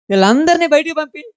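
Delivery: preacher repeats Jesus's command to the congregation, proclaimed loudly; emphatic repeat. Shown as a demonstration. Ring out వీళ్ళందరినీ with a high rising call. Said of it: "ఏమన్నాడు?".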